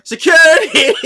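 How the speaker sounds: high, loud shout for security; yelled call. Retold or imitated. Girl yells for help at the man, loud high shout. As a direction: yell out for help, high and sudden. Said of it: "the girl yells for".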